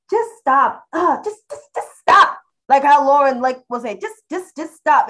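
exasperated. Real, not acted. Exasperated about rehabilitated figures.

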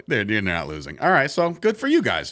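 usual speaking voice

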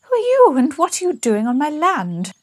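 high, loud, outraged demand; imperious outburst, pitched high and loud; indignant challenge. Acted. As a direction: challenge a trespasser in a hammed-up, high voice. Startled lady confronts intruder in story.

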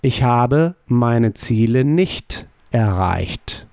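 slow, careful German negation; slow model answer. Shown as a demonstration. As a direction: deliver it slowly as a model. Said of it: "How would you say".

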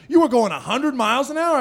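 high, loud mock accusation; heated cop. Cop accusing the driver of speeding, high loud heated voice. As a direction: throw the charge at the driver, worked up. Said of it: "he comes up hot".

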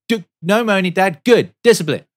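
put-on high, loud child's voice; son scolding. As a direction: scold dad like a stern little kid. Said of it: "he's like".

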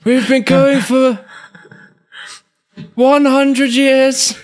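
put-on high, drawn-out quavering old voice; comic high-pitched old person; put-on old-timer voice. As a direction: stretch it out like a very old podcaster. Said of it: "What's happened to your voice".

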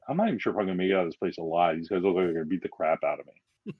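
fast, hurried worry; nervous inner thought. Retold or imitated. Speaker fearing the roll-call cops, fast nervous inner voice. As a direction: rush it like you want out alive.

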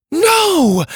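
high, loud cry of horror; anguished cry. Acted. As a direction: cry out as he falls. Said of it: "the twins cried".

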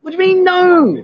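high, incredulous protest; mock indignation. Real, not acted. Host to Ply after she refuses his restaurants, mock indignation.

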